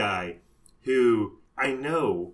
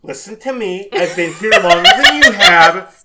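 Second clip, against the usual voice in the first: veteran coworker; 5 semitones higher, 14 dB louder than usual. Retold or imitated. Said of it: "they're like".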